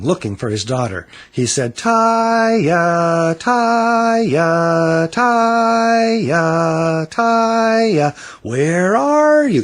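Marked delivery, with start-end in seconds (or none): 1.8-9.6 high, louder long calls; calling out. A narrator's reading. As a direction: call across the store. Father searching calls daughter.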